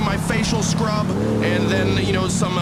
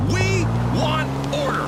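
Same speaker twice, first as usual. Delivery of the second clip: slow, drawn-out demand, word by word; emphatic demand; 10 semitones higher, slower than usual. Acted. Radio host making sure America hears him, slow emphatic demand. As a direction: stretch each word to drive it home.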